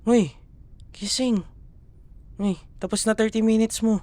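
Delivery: teasing wake-up call; calling out to wake someone. Acted. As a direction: call them out of sleep. Waking the listener up.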